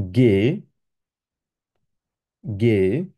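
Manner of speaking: গে said with a hard G as "geh"; German letter G. Shown as a demonstration. Teacher modelling German G. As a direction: model গে for students. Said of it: "সেটা আমার সাথে উচ্চারণ করার চেষ্টা করবেন".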